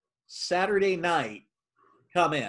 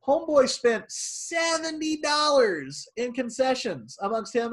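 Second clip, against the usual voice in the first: excited; 7 semitones higher, slower than usual. Real, not acted. Telling the cohost about a big spender, excited. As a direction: stretch it out, amazed at the spend. Bragging about a great customer.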